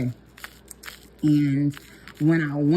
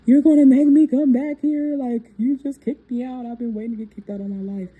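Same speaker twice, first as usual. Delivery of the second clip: high, loud, wailing protest to mom; half-laughing mock boo-hoo wail; retold crying protest; 9 semitones higher, 7 dB louder than usual. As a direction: replay her teenage sobbing complaint. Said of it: "I was boo-hoo crying".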